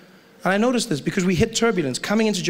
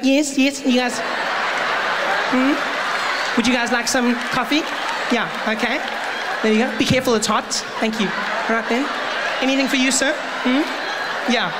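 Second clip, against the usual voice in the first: air hostess; 5 semitones higher than usual. Acted. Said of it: "They'll be like".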